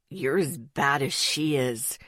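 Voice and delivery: low, slow grumble; grumbled. A narrator's reading. Zeke grumbles at Rory over the pill, low and slow. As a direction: sulk it out, x0.7. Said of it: "Zeke grumbled".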